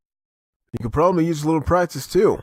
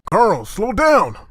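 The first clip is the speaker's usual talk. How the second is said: raised, slowed warning call; 7 semitones higher, 3 dB louder, much slower than usual. Acted. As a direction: call after the boy to slow down. Father calling after running son.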